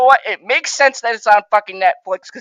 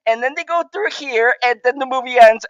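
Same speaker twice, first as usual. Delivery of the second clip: ordinary talk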